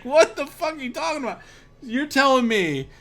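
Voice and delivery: high, fast, loud disbelief; retold incredulous outburst. Roommate hearing the shower excuse, incredulous outburst. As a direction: blurt it out high and quick, dumbfounded. Retold reaction to absurd confession.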